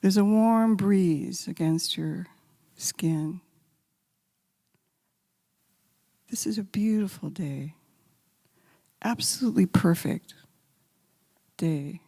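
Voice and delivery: a calm voice guiding listeners with their eyes closed; guided meditation. Acted. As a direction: lead them gently along the path.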